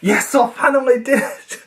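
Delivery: high-pitched, laughing victory cry; overjoyed, breaking into laughter; triumphant cry. Pretend. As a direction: celebrate finally managing it. Mock triumph after long effort.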